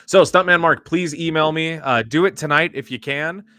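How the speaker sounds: loud, high call to the winner; hyped giveaway announcement. Real, not acted. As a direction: call the winner's name out big. Calling out to the winner.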